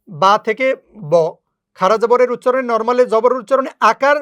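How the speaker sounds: normal talking voice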